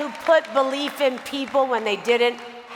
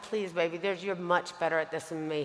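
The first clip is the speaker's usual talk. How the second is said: softer and lower, a hushed plea; soft pleading; 7 semitones lower, 10 dB quieter than usual. Retold or imitated. [name] begging her mentor to speak instead, hushed. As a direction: beg him quietly to take it. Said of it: "I said".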